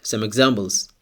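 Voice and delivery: ordinary talk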